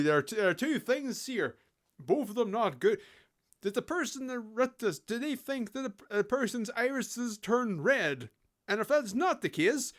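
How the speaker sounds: ordinary talk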